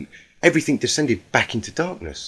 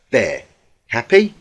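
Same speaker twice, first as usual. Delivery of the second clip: a snippy jab at the end; sarcastic sulk; 6 dB louder than usual. A narrator's reading. Spike crossed his arms, sulking.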